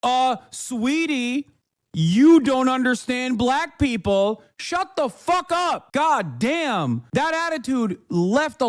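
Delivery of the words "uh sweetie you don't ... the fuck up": slow, higher, sneering sweetie voice; patronizing mock voice climbing higher to the end; mock condescending chatter. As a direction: drag it out like a smug know-it-all. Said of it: "in here instantly being like".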